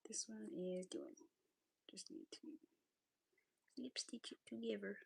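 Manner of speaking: whispered to herself; whispering. Real, not acted. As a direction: mutter it softly while working. Muttering while crafting.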